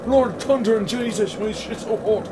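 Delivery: put-on loud, high hammy Irish accent; over the top Irish accent. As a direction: ham up a thick Irish brogue. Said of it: "Why are you doing a bad Irish accent".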